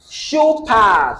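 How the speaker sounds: "Shoe pad" with SHOE high and long, pad falling; teacher's demo of "Shoe pad", first word stressed. Shown as a demonstration. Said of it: "stress shoe".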